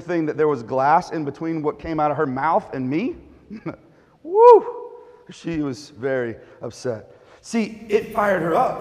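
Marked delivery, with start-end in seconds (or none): 4.2-4.7 a high, loud laughing woo; whooping laugh. Real, not acted. Laughing at his own story.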